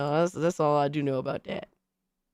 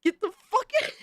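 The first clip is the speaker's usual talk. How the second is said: outraged yelp; faster than usual. Real, not acted. Outraged reaction to fan art.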